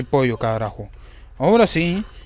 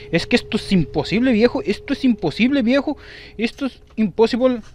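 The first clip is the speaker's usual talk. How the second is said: plaintive repeated protest, pitched up but not shouted; exasperated complaint; 7 semitones higher than usual. Real, not acted. Gamer complaining the match is impossible, high exasperated protest. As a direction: voice climbing high in disbelief.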